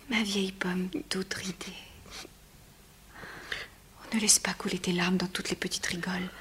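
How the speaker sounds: soft, hushed comfort; tender whisper. Acted. Antigone gently comforts her weeping nurse in a whisper. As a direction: soothe her tears.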